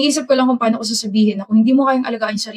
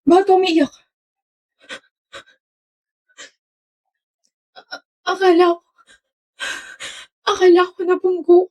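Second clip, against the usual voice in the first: high, tearful stammer; crying; 7 semitones higher than usual. Acted. Remembered girlfriend crying over near crash, tearful stammer. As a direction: choke out the words through tears. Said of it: "naiyak ka pa nga".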